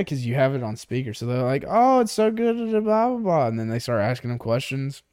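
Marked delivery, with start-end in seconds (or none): 1.7-3.5 high, sing-song phone chirp; exaggerated high-pitched small talk; put-on gushing. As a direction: imitate a fake-enthusiastic call agent. Imitates gushing call agent.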